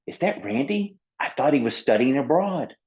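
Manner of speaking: high squeaky little penguin, nosy; a tiny penguin spreading gossip; gossipy little penguin. Acted.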